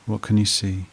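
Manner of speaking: even, level meditation voice with long pauses; guided meditation. Acted. Therapist guiding listeners back through a painful memory, guided meditation voice. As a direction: guide the listener steadily, leaving long silences between prompts.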